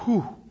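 one breathy puff of relief; relieved whew. Retold or imitated. Group sitting out in the desert, acted relief. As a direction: blow out a "whew", acting it. Relief after escaping cave.

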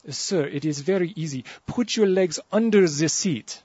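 slow, put-on French accent; patient instructions in a thick French accent. French steward coaxing a tall passenger, put-on French accent. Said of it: "the little French guy leans over to me".

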